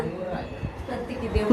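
barely audible, slow, through tears; choked, very quiet reply; tearful whisper. Real, not acted. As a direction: answer softly while crying. Crying girl answers the vlogger.

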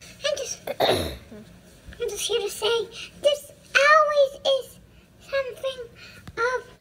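put-on high little kid voice; toddler voice. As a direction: speak like a tiny toddler. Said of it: "that's my little toddler voice".

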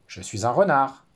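retort. Acted. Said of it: "rétorqua le renard".

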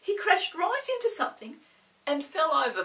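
usual speaking voice

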